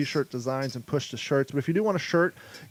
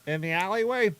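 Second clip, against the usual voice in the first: high-pitched sidekick voice; 4 semitones higher than usual. Pretend. Steve's reply in skit.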